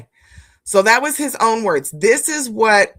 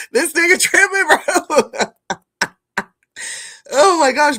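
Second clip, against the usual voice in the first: higher, louder, cracking up on the words; laughing disbelief; 8 semitones higher, 3 dB louder than usual. Real, not acted. Streamer repeating his own joke to chat, laughing. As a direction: say it again but lose it laughing.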